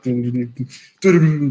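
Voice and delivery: bouncy run of doo-doos; sing-song doos for each jump; little cartoon hopping noise; imitated hopping sounds. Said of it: "going, like".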